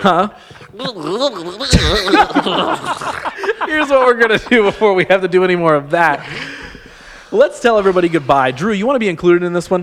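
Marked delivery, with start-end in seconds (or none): 0.7-6.2 laughing through the words. Real, not acted. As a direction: giggle through the line. Laughing while speaking.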